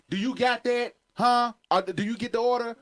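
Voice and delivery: put-on high, loud, pushy girlfriend; mock attitude. Girlfriend badgering drive-thru worker, high mock attitude. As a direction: needle the cashier. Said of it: "she be like".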